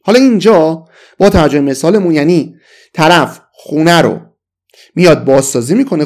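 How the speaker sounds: ordinary delivery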